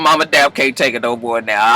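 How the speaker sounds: usual speaking voice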